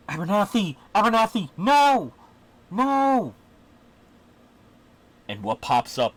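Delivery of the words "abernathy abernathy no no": put-on high-pitched, panicked children's shouts; children yelling. Game master voices two children yelling to the hero, high loud cries. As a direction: cry out high and loud, voicing kids in danger.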